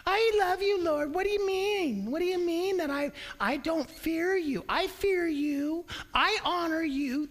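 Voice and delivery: high, whining protest of innocence; mock innocent whine. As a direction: whine like someone falsely accused. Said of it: "This is what happens everywhere".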